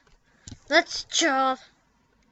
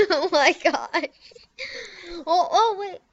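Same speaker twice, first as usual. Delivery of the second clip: higher, laughing through the words; giggling; 7 semitones higher, 4 dB louder than usual. Real, not acted. Laughing and higher after trolling a friend. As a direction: giggle helplessly.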